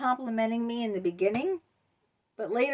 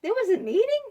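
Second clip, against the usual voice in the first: mock surprise; 13 semitones higher, 6 dB louder than usual. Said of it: "you go".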